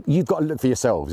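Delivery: ordinary delivery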